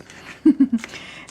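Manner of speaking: short breathy embarrassed laugh; light laugh. Real, not acted. After fixing her grammar mistake, a sheepish laugh.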